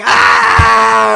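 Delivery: loud jeering "Ah!" from the cellmates; group jeering shout. Retold or imitated. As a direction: whoop at him like the whole cell. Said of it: "they're all like".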